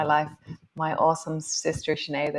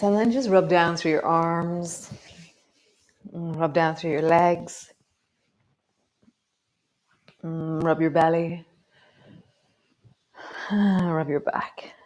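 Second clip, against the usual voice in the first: guided meditation. Acted. Yoga teacher guiding students through self-massage, soothing. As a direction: coax them gently through the body.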